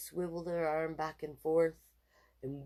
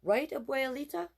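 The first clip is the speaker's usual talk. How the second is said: appealing to grandma; 10 semitones higher, 4 dB louder than usual. A narrator's reading. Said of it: "said Jessie".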